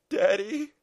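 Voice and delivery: put-on high, loud tearful child's cry; sobbing child. Son with gun at his head, high acted sob. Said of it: "he sobbed".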